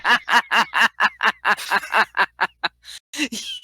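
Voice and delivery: real rapid-fire cackle of amusement; long staccato laugh. Real, not acted. Co-host cracking up at the flooring joke, laughing. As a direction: crack up in a long run of ha-has.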